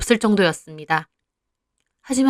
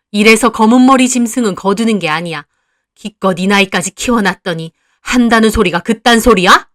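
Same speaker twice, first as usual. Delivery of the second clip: loud, contemptuous scolding; bitter angry mother; 5 dB louder than usual. Acted. Mother calling her daughter an ungrateful beast, loud and bitter. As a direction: cut her down coldly.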